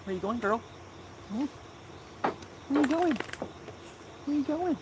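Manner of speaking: high cooing voice for the dog; pet talk. Real, not acted. Calling the wandering dog.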